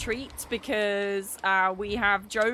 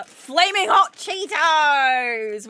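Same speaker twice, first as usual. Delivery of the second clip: excited; 5 semitones higher, 9 dB louder, much slower than usual. Real, not acted. Showing off favourite crisps.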